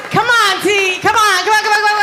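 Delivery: rowdy crowd hype; urging the crowd. Real, not acted.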